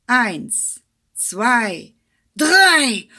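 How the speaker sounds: shouted countdown, rising to a yell on the last number; loud count-off. Acted. Hare counting off the race start, shouting.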